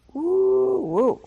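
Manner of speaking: a high whooping woo, then dropping; mock hooting. Real, not acted. Preacher hoots at the woman's exposure, amazed. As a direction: let out an amazed hoot.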